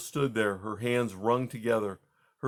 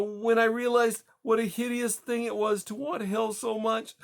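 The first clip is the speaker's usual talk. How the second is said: high, tearful, building to hysteria; rising hysteria; 11 semitones higher than usual. Acted. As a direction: let the panic climb with each clause. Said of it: "Her voice rose in hysteria".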